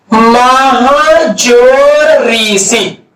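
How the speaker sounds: very slow, drawn-out dictation of the example; teacher spelling it out for the class. Shown as a demonstration. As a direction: stretch "महाजोर ऋषि" out sound by sound. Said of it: "teacher dictates the example".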